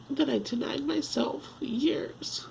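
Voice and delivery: high, slow, voice giving out to breath; real tearful upset. Real, not acted. Woman regretting years she denied herself, tearful and breaking off. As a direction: drag the words out, close to crying. Upset over her lost dreams.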